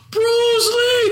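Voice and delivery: high yelled Bruce Lee; mock battle cry. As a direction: belt it like a kung fu cry. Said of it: "You were like".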